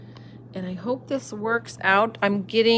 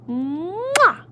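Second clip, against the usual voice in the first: kiss sound; 10 semitones higher than usual. Acted. Kiss on palm.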